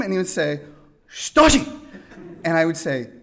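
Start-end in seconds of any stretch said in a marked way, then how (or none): 1.1-1.6 put-on high, loud accented name; imitating Toshi. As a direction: state it proudly. Toshi saying his name.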